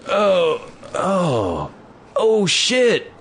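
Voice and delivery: high, slow, wailing fake dismay; hammed-up dazed drunk. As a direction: drag it out like a drunk faking panic. Drunk guy fakes lost keys.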